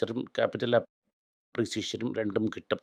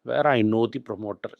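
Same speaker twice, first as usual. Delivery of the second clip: usual speaking voice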